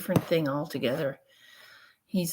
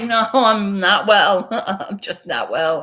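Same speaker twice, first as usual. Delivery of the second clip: loud rueful admission; 4 semitones higher, 8 dB louder than usual. Retold or imitated. Telling the staff she's unwell, rueful laughing admission. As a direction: own it with a sheepish laugh.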